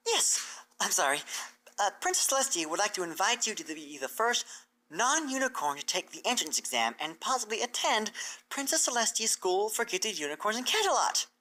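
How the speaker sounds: a thrilled mare announcing big news; Twilight's voice. Acted.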